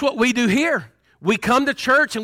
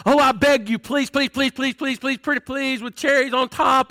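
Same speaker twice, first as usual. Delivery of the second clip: rapid string of pleases, higher pitch; mock begging; 4 semitones higher than usual. Preacher mocking what people think beseech means, mock begging. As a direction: plead like a wheedling child, hammed-up. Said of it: "I'm begging you".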